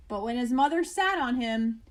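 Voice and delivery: ordinary delivery, nothing marked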